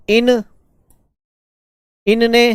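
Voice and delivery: slow, spaced dictation with a pause after each word. Acted. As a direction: call out each word clearly so the students can write it. Dictates word forms to students.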